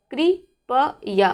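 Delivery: कृपया stretched out very slowly, syllable by syllable; very slow model word. Shown as a demonstration. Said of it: "जिस प्रकार से मैं उच्चारण कर रही हूँ".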